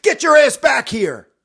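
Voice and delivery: full, high-pitched commanding shout; angry shout. Acted. Captain shouting at the fleeing private, furious. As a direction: yell after someone running away. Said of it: "shouts at the private".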